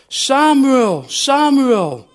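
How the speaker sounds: high, loud call from afar; a loud, high voice calling through the night. Acted. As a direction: call the boy's name across the dark. God calls a third time.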